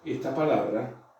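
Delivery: normal talking voice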